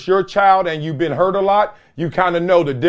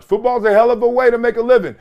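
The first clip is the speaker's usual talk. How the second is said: hell of a way to make a living, said brightly; punchy football's a hell of a way; louder, faster take; 7 semitones higher, faster than usual. Shown as a demonstration. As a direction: push the first take up and out. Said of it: "repeated phrase".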